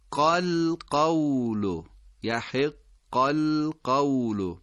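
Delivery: یحق القول joined slowly and precisely; slow recitation linking; careful liaison. Shown as a demonstration. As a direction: link یحق into القول with care. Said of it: "در این اتصال دقت بفرمایید".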